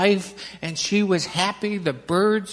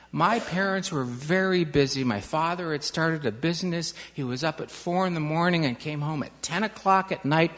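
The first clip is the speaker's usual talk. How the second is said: ordinary delivery, nothing marked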